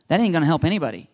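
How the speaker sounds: ordinary delivery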